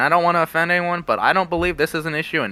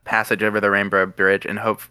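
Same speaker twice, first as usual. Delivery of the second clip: solemn prayer voice, lower; 5 semitones lower than usual. Pretend. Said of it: "give a quick prayer".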